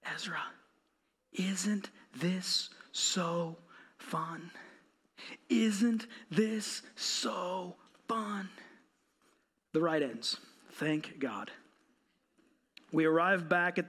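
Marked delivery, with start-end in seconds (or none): 0.0-8.5 slow, soft, drawn-out teasing; softer, slower mock sweetness; whispered taunt. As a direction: draw out "so" in a hushed tease. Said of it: "I keep saying those words over and over".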